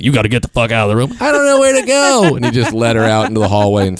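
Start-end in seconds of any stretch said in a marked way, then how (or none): 0.0-1.2 rushed order. Retold or imitated. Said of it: "He's like". 1.2-2.3 very high, pleading put-on voice; mock pitiful girl thrown out; helpless stripper. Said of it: "stripper's reply".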